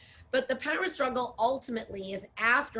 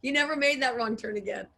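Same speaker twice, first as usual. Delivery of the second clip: laughing; 4 semitones higher than usual. Real, not acted. Regaining composure after laughter.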